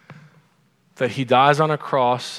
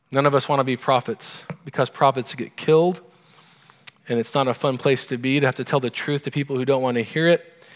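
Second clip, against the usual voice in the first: ordinary talk, nothing marked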